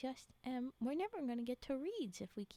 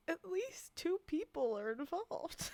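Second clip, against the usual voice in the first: laughing; 4 semitones higher than usual. Real, not acted. Laughing through reading chat.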